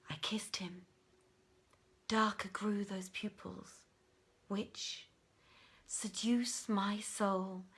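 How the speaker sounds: soft, near-whispered reading of verse; hushed poem recital. A narrator's reading. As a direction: breathe the lines like a love poem. Poem quoted in narration.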